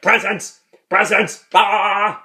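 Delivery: high, loud demanding shouts, ending in a yell; Jack. Retold or imitated. Fan imitates Father Jack demanding Christmas presents, shouting high. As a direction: shout it like a greedy tantrum. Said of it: "Jack was shouting for presents".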